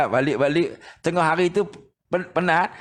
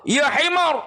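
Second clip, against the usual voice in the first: higher imitated rebuke from the teacher; mock angry rebuke; 8 semitones higher, slower than usual. Blind teacher scolds a wrong recitation, higher mock rebuke. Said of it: "Oh, dia marah".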